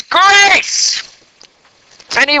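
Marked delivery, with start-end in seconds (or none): 0.0-1.0 mock shout. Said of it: "And he says".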